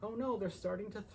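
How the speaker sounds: ordinary delivery, nothing marked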